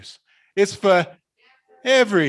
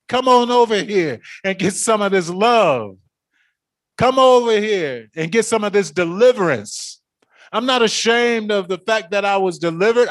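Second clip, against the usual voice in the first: higher and fast, rising fervor; full-force preaching; 7 semitones higher, 4 dB louder than usual. Acted. Preacher calling the congregation to deliverance, fervent. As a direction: preach it, call them in.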